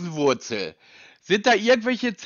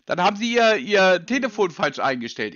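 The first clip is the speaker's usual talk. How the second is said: ordinary delivery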